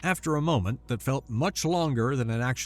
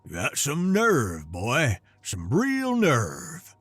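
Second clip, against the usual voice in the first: put-on slow, gruff old man with a regional drawl; gravelly accented old grandpa; slower than usual. A narrator's reading. Grandpa scolding his grandson on a video call, gruff and slow. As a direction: growl it out like a scolding old rancher. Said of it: "his voice gravelly and thick with an accent".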